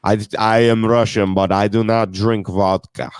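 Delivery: put-on Russian accent. Host joking that he's Russian, mock Russian accent. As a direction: imitate a Russian speaking careful English. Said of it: "a real Russian with a Russian accent".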